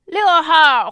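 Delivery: ordinary talk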